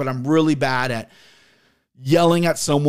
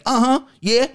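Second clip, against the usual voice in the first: put-on sing-song country girl answering the store phone; country lady; 9 semitones higher than usual. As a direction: play the chirpy Lowe's phone lady from the country. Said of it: "That's what she's doing".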